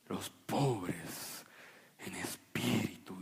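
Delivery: very low, slow, fading into a breathy hush; mock the holy film voice; deep movie-prophet voice. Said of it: "se-, profunda".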